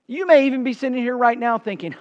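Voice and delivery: ordinary talk, nothing marked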